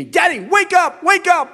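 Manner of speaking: put-on high, loud little boy calling; child shouting. Small son shouting at dad in the night, high and loud. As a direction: shout like a three-year-old waking his dad.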